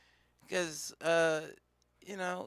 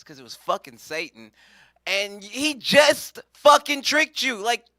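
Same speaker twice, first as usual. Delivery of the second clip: ordinary talk, nothing marked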